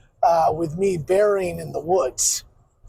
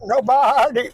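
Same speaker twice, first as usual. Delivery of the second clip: bleating like a ram; 2 semitones higher, 4 dB louder than usual. Shown as a demonstration. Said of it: "I have a keyword to get me into goat".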